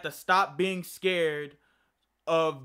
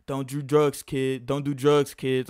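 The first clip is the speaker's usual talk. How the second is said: lower, mocking preachy voice; mock lecturing friend; 7 semitones lower than usual. Chico lecturing the stoned narrator in a lower mock voice. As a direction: parody a friend lecturing you, pitched lower. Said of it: "he'd say shit like that".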